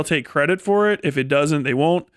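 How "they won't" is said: ordinary talk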